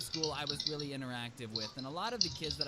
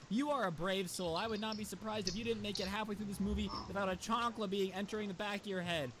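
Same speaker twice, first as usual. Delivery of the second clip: usual speaking voice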